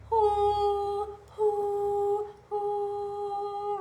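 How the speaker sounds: soft owl hoo sounds; owl hoots. Shown as a demonstration. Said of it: "Can you do it?".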